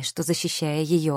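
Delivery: ordinary delivery, nothing marked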